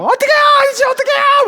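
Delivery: panicked plea. Retold or imitated. Frightened driver pleading with the dock worker, mock shriek. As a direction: beg the man in a squeal of fright. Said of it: "막 이렇게 얘기하는데".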